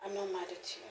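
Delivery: quiet, loving reassurance; tender apology to unborn baby. Retold or imitated. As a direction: speak softly to the baby you carry as if in your hands. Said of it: "lay my hands on my five weeks or six weeks pregnancy and say".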